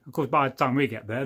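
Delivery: ordinary talk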